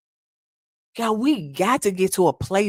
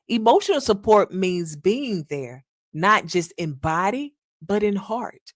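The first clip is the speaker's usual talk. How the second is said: normal talking voice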